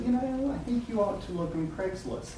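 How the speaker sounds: gentle high-pitched impression of a wife; imitated wife. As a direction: voice the wife lightly, high and soft. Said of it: "again, my wife".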